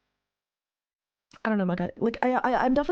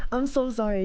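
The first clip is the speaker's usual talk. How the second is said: usual speaking voice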